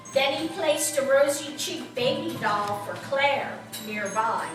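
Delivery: usual speaking voice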